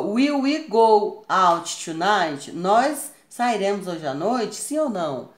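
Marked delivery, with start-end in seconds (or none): 0.0-2.5 very slow, louder teacher reading; slow emphatic example question; slow model question. Shown as a demonstration. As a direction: stretch each word for the class. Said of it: "Olha só".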